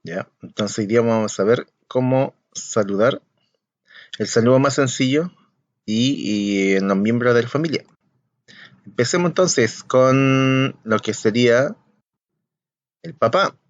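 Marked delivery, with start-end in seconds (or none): none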